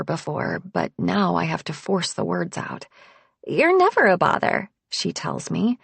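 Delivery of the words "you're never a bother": higher and brighter for Harper; cheerful friend voice. A narrator's reading. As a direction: reassure her friend, light and high.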